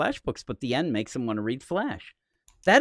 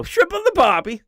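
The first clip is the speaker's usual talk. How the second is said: high, loud bad Scottish accent on "Shrimp on the Barbie"; 9 dB louder than usual. Host botching a Scottish accent for his cohost. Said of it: "Is that your attempt at a Scottish accent".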